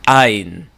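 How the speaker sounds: Ain said from the back of the throat; ayin sound. Shown as a demonstration. Teacher demonstrating a throaty ayin sound. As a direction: Ain with a tight throat catch. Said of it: "That is the sound".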